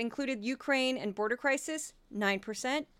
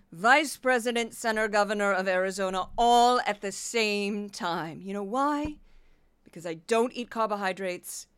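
Kari Lake impression. Podcaster doing Kari Lake bragging to fans, grandiose. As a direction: declare it like a vain politician. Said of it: "and a brief Kari Lake".

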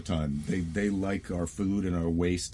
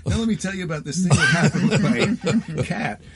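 much higher, louder and laughing; hammed-up stage patter; 10 semitones higher, 8 dB louder than usual. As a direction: lurch back into the act with forced showmanship. Acting abrupt return to the act.

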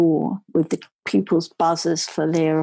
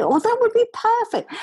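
delighted. Retold or imitated. Delighted reply to the mixer offer.

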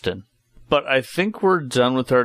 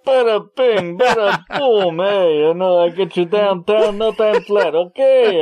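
loud, high New York cabbie voice; 10 semitones higher, 6 dB louder than usual. Pretend. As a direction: shout it like a brash New York cab driver. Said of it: "your Bane bits".

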